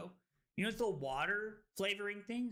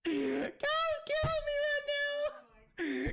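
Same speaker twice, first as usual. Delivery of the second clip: much higher, slow, cry-laughing; laughing so hard; much slower than usual. Real, not acted. Streamer cracking up at a friend's joke, cry-laughing. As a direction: squeeze the words out through helpless laughter.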